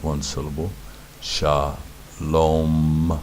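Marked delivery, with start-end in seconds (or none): 1.2-3.2 "Shalom" stretched into two long syllables, sha and lom. Shown as a demonstration. Teacher shows where the syllable stops, syllable by syllable. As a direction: draw out "Shalom" with a gap between syllables. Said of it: "stopping the syllable here".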